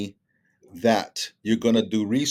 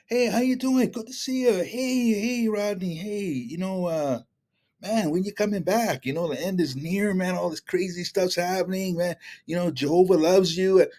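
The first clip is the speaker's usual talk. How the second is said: high, far-off mock friendly witness voice; imitating a cheerful witness acquaintance; imitating a preachy friend; 7 semitones higher than usual. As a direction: play the eager believer calling out to Rodney.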